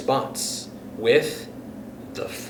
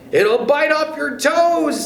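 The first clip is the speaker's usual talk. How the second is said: Mr Twit scaring her; 13 semitones higher, 3 dB louder than usual. Acted. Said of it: "said Mister Twit".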